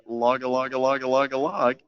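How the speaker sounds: half-yelled imitation of a rallying cry; mild retold yell. Retelling a block tag call, mildly yelled chant. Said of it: "started yelling".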